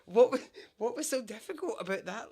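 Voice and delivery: can't believe how easy that was; incredulous outburst. Retold or imitated. Speaker to the clerk after the old woman's help, loud disbelief. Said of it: "I'm like".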